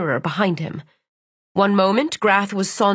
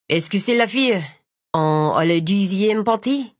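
put-on French accent. Man asks in French.

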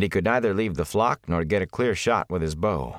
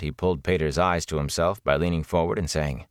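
ordinary delivery, nothing marked

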